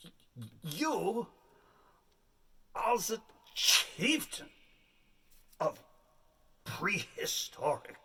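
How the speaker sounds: hammed-up director, slow and halting; a hint of a dramatic director giving orders. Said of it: "He says".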